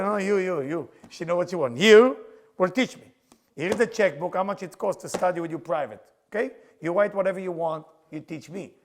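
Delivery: insistent demand. Retold or imitated. Pushy student insisting on lessons.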